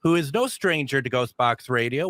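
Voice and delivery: normal talking voice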